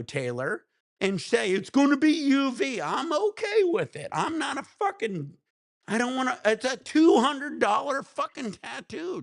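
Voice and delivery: real irritation, pitched high and heated; angry rant. Real, not acted. Streamer rants at Taylor about the tattoo artist in a high, heated voice. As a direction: vent at the tattoo artist, fed up. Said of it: "I'm so pissed".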